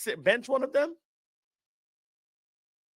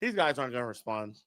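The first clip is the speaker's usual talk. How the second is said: resigned mutter; 8 semitones lower, 8 dB quieter than usual. Retold or imitated. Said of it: "and I'm like".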